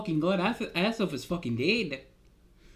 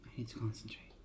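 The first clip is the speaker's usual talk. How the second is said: much softer whispered aside; 9 semitones lower, 16 dB quieter, faster than usual. Acted. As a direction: mutter it under your breath. Focusing before the vision.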